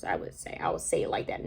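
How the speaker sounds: breathy whisper to herself; whispered aside. Real, not acted. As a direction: whisper it as a private afterthought. Aside after searching for words.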